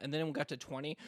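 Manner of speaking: ordinary talk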